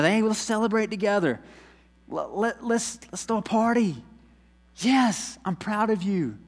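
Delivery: overjoyed master praising his servant; joyful praise. Acted. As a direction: throw a party with your voice. Voicing God's joyful praise.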